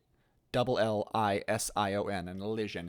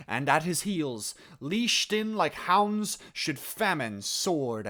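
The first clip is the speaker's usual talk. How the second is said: full speech, louder, leaning hard on the nouns and verbs; emphasis on key words; 3 semitones higher, 5 dB louder than usual. Shown as a demonstration. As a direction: paint the images by stressing the key words. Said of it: "still bearing in mind my emphasis".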